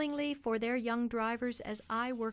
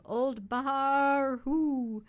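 slow, louder drawn-out street cry; old man's street cry; 3 semitones higher, 7 dB louder, much slower than usual. Retold or imitated. Children mimicking the coal man's cry, slow and loud. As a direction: call it out like a coal seller hawking. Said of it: "for it sounded like that".

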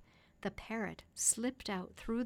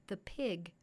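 ordinary delivery

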